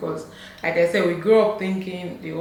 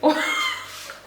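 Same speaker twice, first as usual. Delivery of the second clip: squealing laugh; high squealing laugh. Real, not acted. Bursts out laughing.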